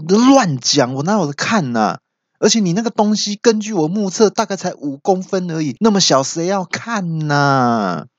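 high, hammed-up scoffing; indignant denial. Old uncle denying that he peeked, indignant. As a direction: protest hotly, then drag out the last jibe. Uncle denies peeking, acted quarrel.

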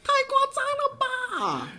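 high, drawn-out shocked cry. Real, not acted. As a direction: wail it in disbelief. Shocked at schoolkids' talk.

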